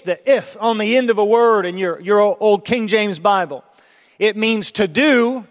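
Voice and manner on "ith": "ith" said as a clear short ith syllable; "ith" said on its own like a teaching sound; the eth sound. Shown as a demonstration. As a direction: sound out the old "ith" ending slowly. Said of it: "when you see that E T H".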